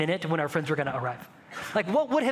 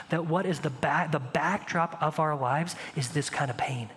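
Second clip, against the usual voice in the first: ordinary talk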